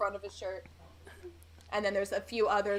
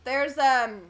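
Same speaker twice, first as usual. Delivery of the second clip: put-on higher, louder rambling drunk; drunk local; 4 semitones higher, 6 dB louder than usual. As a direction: stumble through the list like a drunk. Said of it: "He goes".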